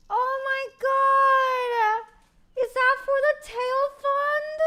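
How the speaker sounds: high, drawn-out wail of amazement; high stretched-out squeal, softening into thanks; overcome with gratitude. Real, not acted. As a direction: gush slowly, as if moved to tears. Gushing over a surprise donation.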